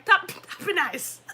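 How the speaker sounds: high, loud, drawn-out burst; mock losing your cool at a child; retold loud scolding. Reenacts louder self, not calm.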